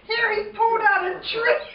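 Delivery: high-pitched, amazed storytelling; squealing with comic amazement; excited retelling. Retold or imitated. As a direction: relive the sighting with rising glee. Excitedly reliving Pappy dragging a tree.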